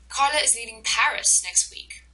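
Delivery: "Paris" punched as the key word; stress on PARIS. Shown as a demonstration. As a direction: put the sentence's main stress on "Paris". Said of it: "but Paris is the".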